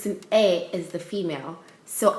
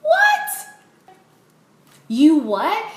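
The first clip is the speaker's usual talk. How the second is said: high, loud shriek of shock; outraged disbelief; 11 semitones higher, 6 dB louder, much slower than usual. Retold or imitated. Retold shock at apology news.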